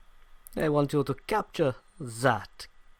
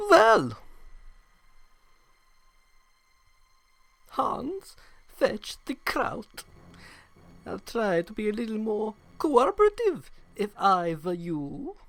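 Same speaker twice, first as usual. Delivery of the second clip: put-on German villain accent; 3 semitones higher than usual. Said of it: "people don't talk like this".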